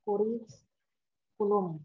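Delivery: ordinary talk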